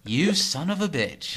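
slow, high, loud playful indignation; mock outrage. Co-host answering a teasing disagreement, mock outrage. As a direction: draw the insult out in pretend fury.

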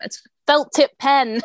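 slow, high, patient-but-done explaining; mock patient; exasperated explanation. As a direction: spell it out like it's obvious. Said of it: "I'm like".

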